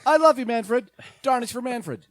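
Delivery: loud, high-pitched fan squeal; exaggerated supporter shouting over the crowd; mock adoring fan. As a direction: cheer with hammed-up devotion. Mock fan cheer for commissioner.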